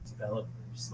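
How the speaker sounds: very soft, low podcast host voice; Roman Mars-like ad voice. Retold or imitated. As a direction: croon it low and hushed, like a polished ad read. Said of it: "I fucking sound like Roman Mars".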